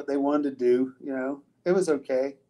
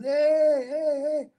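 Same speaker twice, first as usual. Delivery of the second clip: drawn-out, high-pitched calls across water; imitating his own far-carrying hail; re-enacted yell for help; 12 semitones higher, 4 dB louder, much slower than usual. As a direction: call out high, as if waving down a distant boat. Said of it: "I'm yelling and I'm waving".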